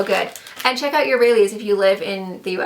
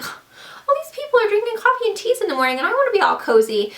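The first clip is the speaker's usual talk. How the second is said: whiny; 11 semitones higher than usual. Retold or imitated. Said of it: "I'm like".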